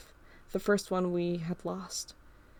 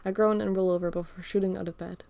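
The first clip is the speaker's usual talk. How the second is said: plain reading voice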